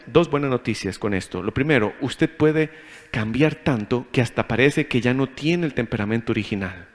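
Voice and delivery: normal talking voice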